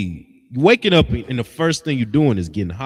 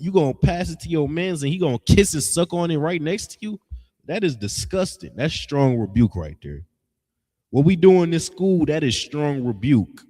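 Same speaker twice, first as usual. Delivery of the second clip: ordinary talk, nothing marked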